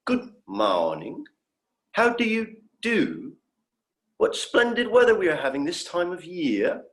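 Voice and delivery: lightly put-on, overly formal phrasebook English; stilted textbook Standard. Alien showing off his practiced Standard to the doctor, stilted formal phrasing. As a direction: recite it like a proud language student showing off.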